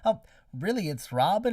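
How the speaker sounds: high, loud, incredulous questioning; mock surprise. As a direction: question the name in retold disbelief. Retold sceptical reply to the name.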